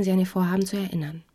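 ordinary delivery

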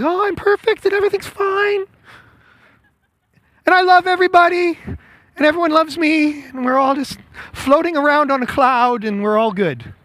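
high, airy fake-pious sing-song; mock super spiritual. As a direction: play a smug saint who thinks all is fine. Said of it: "If we pretend and be super spiritual".

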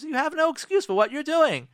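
high, loud accusation; forceful, high-pitched demand to take accountability; open blaming. Acted. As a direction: drop the mask and blame them outright. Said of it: "What I'm really saying to you".

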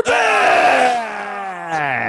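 long, loud, slow yell; drawn-out shout. Real, not acted. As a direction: stretch it out into a big shout. Mic back, shouting return.

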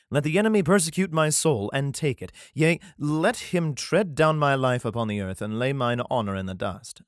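steady, solemn reading of a psalm; scripture reading. Acted. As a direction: read it like a Bible passage. Reads the psalm aloud.

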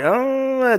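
high, held "ohhh" of reaction; drawn-out "ohh". Retold or imitated. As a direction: stretch out a high-pitched oh. Reacting to a conversation he retells.

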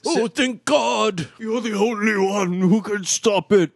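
put-on high, shouted crazy old man. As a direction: yell it like a frantic old man. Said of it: "a real crazy old man voice".

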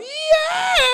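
high triumphant scream; screaming rock yell; rock-star yell. Pretend. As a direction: roar it to the stadium. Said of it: "an angel from heaven's gonna come down going".

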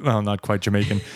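ordinary delivery

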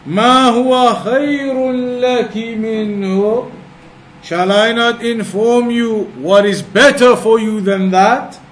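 usual speaking voice

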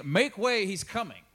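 high, loud announcement; prophet's call. Retold or imitated. Preacher voicing John announcing the Lord, loud. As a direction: announce it to the crowd. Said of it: "he's saying".

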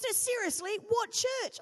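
mock pushy questioner; insistent radiographer. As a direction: press her for the answer. Retold grumpy radiographer insisting.

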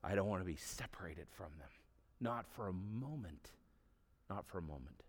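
soft, hushed imitation of a sorrowful prayer; low, subdued plea of Jesus; understated weeping plea. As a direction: plead quietly, grieving, without actual sobs. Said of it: "he's weeping and saying".

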